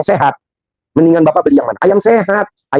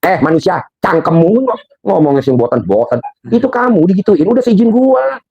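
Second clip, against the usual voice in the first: voicing Allah; 6 semitones higher than usual. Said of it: "Kata Allah".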